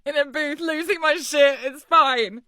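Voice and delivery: high, whiny voice on the edge of tears; high-pitched pity-party whine; mock-tearful self-pity. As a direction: feel sorry for yourself, trailing to a tiny whimper. Alone in booth, losing it.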